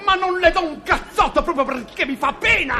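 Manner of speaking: high-pitched, spluttering anger; exasperated high outburst; furious threat. Acted. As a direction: threaten him at the end of your patience. Threatens to punch the joker.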